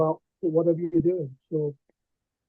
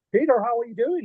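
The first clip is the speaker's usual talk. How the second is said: higher-pitched, badly imitated Irish accent; poor Irish accent; 6 semitones higher than usual. Retelling a famous pal greeting him in the pub, poor Irish accent. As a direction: have a rough go at an Irish accent. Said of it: "in his Irish accent, which I'm not very good at".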